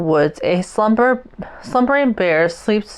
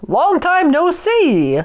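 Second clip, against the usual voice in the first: high, friendly hare voice; a hare greeting Mouse after a long time; cheery hare; 9 semitones higher than usual. A narrator's reading. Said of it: "says Hare".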